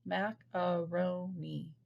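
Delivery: Macaroni said slowly, each of its four syllables separate; slow demo of the syllables in Macaroni. Shown as a demonstration. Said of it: "Your chin should hit your hand four times".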